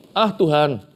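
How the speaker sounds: a drawn-out grumbling protest to God; complaining Moses. Acted. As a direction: sigh it out like a reluctant servant. Preacher acts out Moses complaining.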